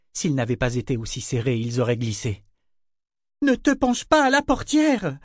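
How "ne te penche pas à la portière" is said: high, alarmed command; ordering. A narrator's reading. Mother orders Malta back from the coach door, urgently. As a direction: call out a warning order in high pitch. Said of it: "ordonna Kefria Ordel à Malta".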